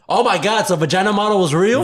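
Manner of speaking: high, loud astonished question; amazed disbelief. Real, not acted. Player learns the odd headline was real, amazed. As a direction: blurt it out in real surprise.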